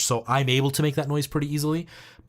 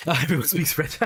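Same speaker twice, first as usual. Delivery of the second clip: said through laughter, higher; laughing; 3 semitones higher than usual. Real, not acted. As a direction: laugh your way through the line. Laughing at a chat remark.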